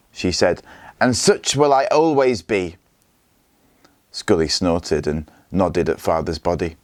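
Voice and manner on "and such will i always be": proud and higher, head held up; defiant. A narrator's reading. The widow defying the warlord, proudly higher. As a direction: answer him with defiance. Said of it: "stared back at Scully defiantly".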